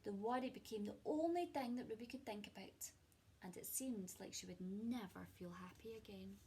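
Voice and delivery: expressive storybook reading with hushed moments; gentle picture-book narration; reading to children. A narrator's reading. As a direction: read it like a cosy bedtime story to a class. Teacher reads picture book aloud.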